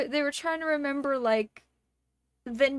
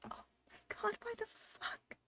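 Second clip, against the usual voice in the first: whispered, higher, in disbelief; exasperated whisper; 9 semitones higher, 17 dB quieter than usual. Retold or imitated. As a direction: hiss it under your breath, fed up. Exasperated inner aside.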